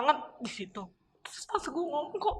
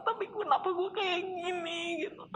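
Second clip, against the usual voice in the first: voice breaking; 7 semitones higher than usual. Real, not acted. Breaks down remembering.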